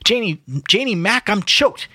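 high-pitched comic howl; howling complaint. A narrator's reading. Mulligan choking on smoke by the fire, howling. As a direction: howl it out in exasperation. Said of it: "he howled without looking up".

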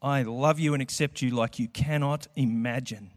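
normal talking voice

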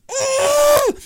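very high, loud strained howl; enraged scream. Retold or imitated. Man at the end of his short fuse, enraged scream. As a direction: scream in mock fury. Retells losing his temper.

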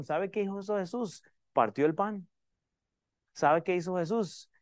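ordinary talk

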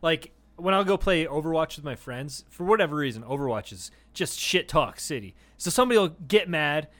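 ordinary delivery

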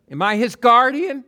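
high, loud mock-innocent question; sarcastic. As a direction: throw it back sarcastically, pitch shooting up.

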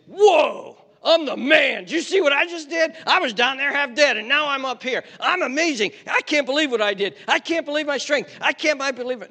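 loud, high-pitched crowing over oneself; boastful amazement. Acted. Rescued man showing off his own strength, boastful amazement. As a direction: brag in high, loud disbelief at your own feat. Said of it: "gonna pop up from there and say".

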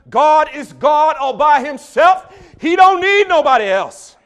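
building from firm to roaring, slow preacher cadence. Retold or imitated. Imitating the Alabama preacher to the congregation, building to a roar. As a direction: climb each line into a full-throated Alabama preacher roar.

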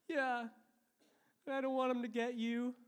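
put-on high, pleading little-boy voice; Joshua. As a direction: imitate a child worried for his dad. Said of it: "And it was Joshua".

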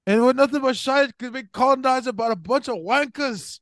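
high-pitched, hammed-up Scottish accent; bad Scottish accent. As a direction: quote the film in a terrible Scottish brogue. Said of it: "Scottish accent".

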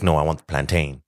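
insistent plantain ending like "rain"; long-A plantain. Retold or imitated. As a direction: correct her with plan-TAYN. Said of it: "So he keeps on saying".

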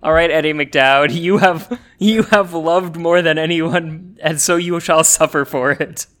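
slow, loud, grand pronouncement; solemn curse voice. Pretend. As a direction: declare it like an ancient curse. Mock curse on Eddie McDowd.

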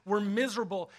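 ordinary talk

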